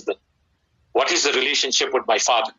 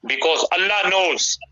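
ordinary talk, nothing marked

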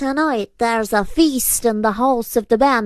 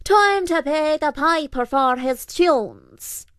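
high, slow creature's call; 7 semitones higher, slower than usual. A narrator's reading. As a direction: announce it to a whole hall, drawn out and high. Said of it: "The púca called out".

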